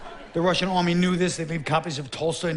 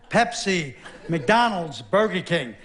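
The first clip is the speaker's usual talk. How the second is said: usual speaking voice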